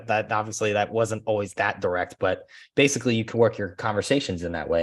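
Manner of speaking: normal talking voice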